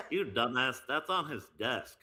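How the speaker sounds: scornful dim cartoon teen; Beavis and Butthead. Correcting his cartoon buddy, Butthead-style put-down. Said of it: "do it how Beavis and Butthead would".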